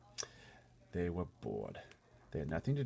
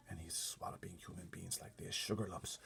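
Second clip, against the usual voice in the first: soft unvoiced whisper; hushed, so no giant hears; said softly; 7 dB quieter than usual. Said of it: "the B F G said softly".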